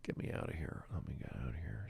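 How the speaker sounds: very soft, low whisper; whispered aside. Real, not acted. Host to himself amid misfiring sound effects, whispered plea to get out. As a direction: whisper it under your breath.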